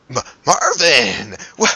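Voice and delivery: big, loud, stretched-out hello; excited greeting. Acted. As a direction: call out to a long-lost buddy, stretching the name. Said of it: "Arian's like".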